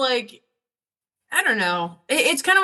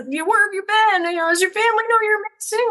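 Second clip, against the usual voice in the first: much higher, quicker pet-talk; weird talking-to-a-dog voice; 11 semitones higher than usual. Retold or imitated. Woman questions a lost dog about its family, weird high pet-talk. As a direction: fuss over a stray dog in a high voice. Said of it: "talking to it like weird".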